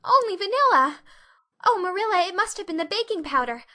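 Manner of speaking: high, loud, fast anxious excuse; frantic girl. Acted. Anne pleading with Marilla, high frantic excuse. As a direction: rush the excuse in a panic.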